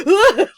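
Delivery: high loud yelp of fright; sudden scared shriek; imitated startled yelp. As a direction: yelp like a man nearly run over. Startled man in golf cart.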